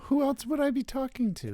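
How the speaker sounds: high-pitched indignant comeback; squeaky-high rhetorical question; mock indignant. Real, not acted. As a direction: voice jumps way up in protest.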